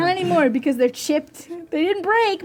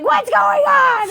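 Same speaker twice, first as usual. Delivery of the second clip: very high loud slow mephit cry; shrill out a mephit's alarm; confused mephit; 13 semitones higher, 8 dB louder, slower than usual. Acted. GM voicing the mephits in chaos, very high loud slow cry. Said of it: "you hear Mephits be like".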